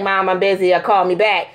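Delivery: low-pitched mock dismissive daughter; imitated brush-off. Mom quoting busy daughter back at her, dismissive.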